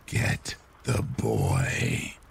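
low, very slow raspy whisper; breathy rasp. A narrator's reading. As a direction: drag it out in a wispy rasp. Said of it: "in a very raspy, wispy voice".

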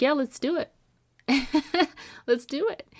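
happy laugh between the words; elated retelling. Retold or imitated. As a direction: bubble over with joy. Retelling the client's yes.

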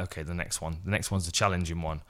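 quiet muttered aside to self; quiet nervous self-talk; hushed inner thought. Retold or imitated. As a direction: say it under your breath, nervous.

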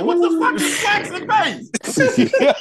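slow, high, loud disbelief; incredulous outcry. Retold or imitated. Stoned man retelling his shock at wax, incredulous outcry. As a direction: squeal it out in comic shock. Shocked reaction to the drug retold.

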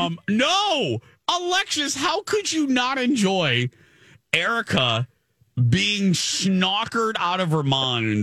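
high-pitched hammed-up outrage; mock indignant protest. Host to cohost, hammed-up high indignant squeal. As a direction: squeal it out in playful disbelief.

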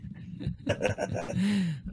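breathy burst of laughter; real laugh at the bit. Real, not acted. Laughing at thrown bottle.

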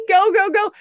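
loud, high "go, go, go"; urgent urging. Retold or imitated. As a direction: cheer yourself into action. Urging self to get moving.

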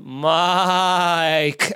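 one long, held-out name; drawn-out greeting. Retold or imitated. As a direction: imitate someone stretching the name endlessly. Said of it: "talks to you like that".

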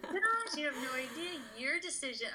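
retold high-pitched gushing to an idol; gushing fan. Gushing to her idol on meeting.

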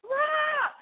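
very high, half-yelled bratty protest; hammed-up shout guarding your closet; mock territorial yell. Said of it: "I used to be like".

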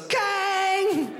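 very high, ringing metallic clang; imitated scale clang. Scale tipping over in the doctor's office, high clanging noise. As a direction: imitate the scale bar slamming upward. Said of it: "It goes".

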